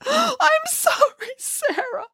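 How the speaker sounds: high, slow crying apology; sobbing Boris. A narrator's reading. Boris sobs an apology to Nanny Piggins. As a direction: sob out the apology. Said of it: "sobbed Boris".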